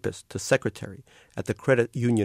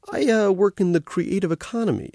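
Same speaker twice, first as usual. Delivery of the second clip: proud, a touch higher, chest a little out; proudly; 11 semitones higher, 4 dB louder than usual. A narrator's reading. Young man tells the older woman his job, mildly proud. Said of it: "I said proudly".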